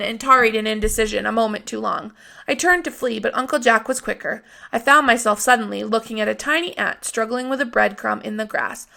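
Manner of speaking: plain reading voice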